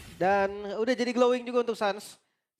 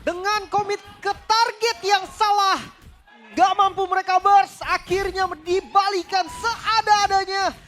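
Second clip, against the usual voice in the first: fighting game announcer; 10 semitones higher, 8 dB louder, slower than usual. Real, not acted. Co-caster yells over the wipe.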